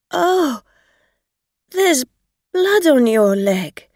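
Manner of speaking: loud, high gasp of horror; halting, appalled cry; horrified. A narrator's reading. Driver sees blood on the leg.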